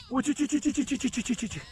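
high soft repeated call to the animals; herding call. Real, not acted. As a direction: coax the flock with a light high chant.

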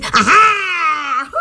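ordinary delivery

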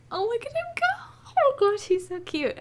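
high, excited cooing; delighted gushing. Real, not acted. Player watching a cute cow go, delighted gushing.

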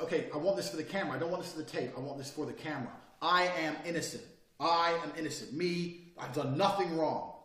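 firm, deliberate insistence, slowing on the last line; firm protest of innocence. Shown as a demonstration. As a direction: plead innocence straight to the camera. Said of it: "Say innocent with your hands up".

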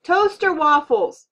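loud, high, drawn-out yell. A narrator's reading. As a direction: holler the answer across the noise. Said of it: "Dad yelled louder".

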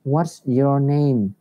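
slow, clear teacher model line; slow model reading. Shown as a demonstration. Teacher models the question slowly for pupils, slow and clear. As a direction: spell it out slowly for the class. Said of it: "thầy dịch lại những câu đấy".

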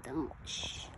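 a breathy hushed shh; whispered hush. Retold or imitated. Hushing the man near the deer.

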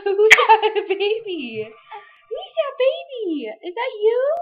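high, slow, loud cooing with a laugh. Real, not acted. Mom showing her baby to the camera, high delighted baby talk. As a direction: coo at him, stretched and delighted. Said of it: "You just go".

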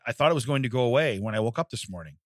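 ordinary delivery

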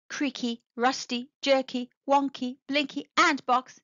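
ordinary delivery, nothing marked

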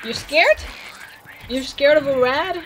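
mocking loud tease; teasing. Real, not acted. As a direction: poke fun at a scaredy-cat. Teasing game character.

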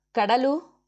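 calling out. A narrator's reading. Agni calls for his wife as he comes home, loud rising call. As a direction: call her name across the house, ending up. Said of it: "ಕೂಗಿಕೊಳ್ಳುತ್ತಲೇ".

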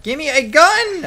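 loud desperate yell for a gun; shouting. Real, not acted. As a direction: shout it out in a panic. Yelling for a gun mid-fight.

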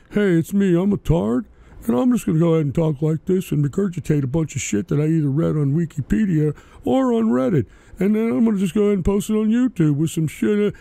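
put-on dim-witted drone; mock dopey voice. Streamer parodying lowbrow YouTubers, in a mocking dopey voice. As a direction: play a clueless YouTuber, dopey and rushed. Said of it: "I should go incognito talking like a".

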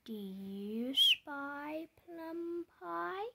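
usual speaking voice

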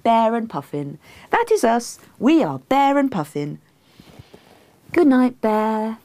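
high, contented singsong; cosy, satisfied bedtime sweetness; happy. A narrator's reading. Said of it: "Me, happily".

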